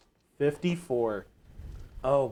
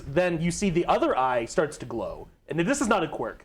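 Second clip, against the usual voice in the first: normal talking voice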